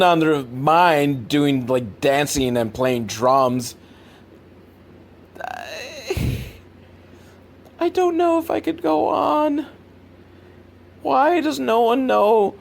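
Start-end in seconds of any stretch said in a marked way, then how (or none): 5.4-12.5 mock despair. Real, not acted. Mock despair over the film.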